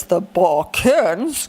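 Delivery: slow, low, put-on voice for The Balkans; funny low voice. Shown as a demonstration. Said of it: "so let's say it like this".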